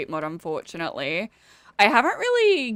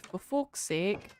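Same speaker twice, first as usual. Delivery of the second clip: slow, softer Scottish-tinged curse; almost Scottish; 6 dB quieter, slower than usual. Shown as a demonstration. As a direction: show off the near-Scottish vowels, slowly and quietly. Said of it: "almost Scottish".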